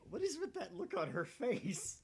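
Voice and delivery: loud, high, amazed reaction; excited outburst. Real, not acted. Reacting to the girl's look on screen, loud and excited. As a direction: blurt it out, half laughing at the screen.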